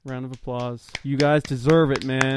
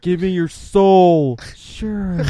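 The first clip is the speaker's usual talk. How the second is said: slow, louder devil bargain; tempting devilish whisper; 5 semitones higher, 9 dB louder, much slower than usual. Retold or imitated. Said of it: "And I was like".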